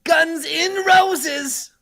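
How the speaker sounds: slow, loud spell call; spell incantation. Acted. Sorcerer casting flame spell at soldiers, slow loud incantation. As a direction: intone the spell name.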